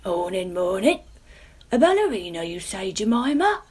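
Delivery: a hint of a booming papa; papa pumpkin. A narrator's reading. Papa joins after a cough.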